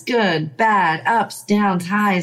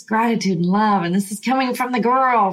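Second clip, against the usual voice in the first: ordinary talk, nothing marked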